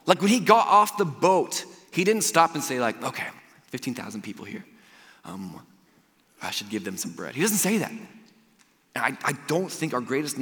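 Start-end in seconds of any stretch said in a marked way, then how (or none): 3.0-7.4 low, very soft thinking aloud; mock casual musing. Jesus imagined sizing up fifteen thousand people, soft mock musing. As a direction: muse under your breath, with pauses.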